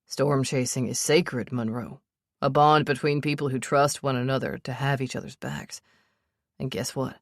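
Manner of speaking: hint of a serious storm chaser; Sebastian, steady and earnest. A narrator's reading. Sebastian's voice in the story.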